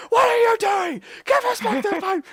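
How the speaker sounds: high, loud chasing shout; teachers shouting. Retold or imitated. Teachers chasing the friend across the oval, loud high shout. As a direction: yell after him like a teacher giving chase.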